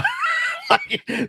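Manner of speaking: sudden high whooping laugh; burst of laughter. Real, not acted.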